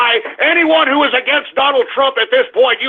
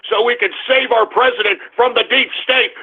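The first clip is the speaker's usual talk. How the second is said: ordinary talk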